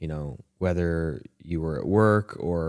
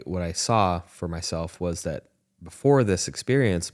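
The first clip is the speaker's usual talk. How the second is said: ordinary delivery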